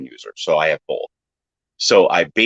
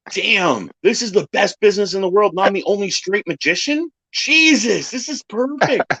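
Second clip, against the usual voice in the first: mock delighted; 13 semitones higher than usual. Retelling his delight.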